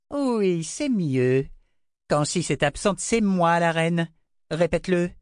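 high, mocking queen's voice; Queen Violette. A narrator's reading. Queen mocking the girl, high and scornful. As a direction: sneer down at the girl.

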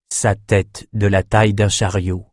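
ordinary delivery

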